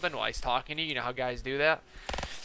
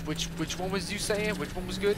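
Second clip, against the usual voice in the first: higher, stammering mock dude; put-on confused customer, pitched up; imitated customer; 7 semitones higher than usual. Customer asking the clerk which mod was good, high imitation. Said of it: "this dude is talking like this".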